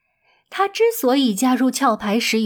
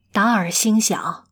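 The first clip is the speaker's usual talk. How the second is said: usual speaking voice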